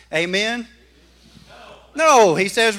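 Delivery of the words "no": sharp, loud correcting no; emphatic loud no. Real, not acted. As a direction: shout the no to correct them. Correcting congregation loudly.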